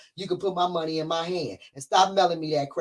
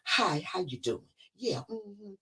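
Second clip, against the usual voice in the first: fake polite politician; 6 dB quieter, slower than usual. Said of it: "they talk like this".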